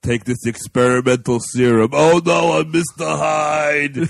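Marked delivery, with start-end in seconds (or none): 1.9-4.0 loud hammed-up Mister Hyde; booming mock-dramatic reveal; mock horror. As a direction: cry out like a melodramatic monster transformation.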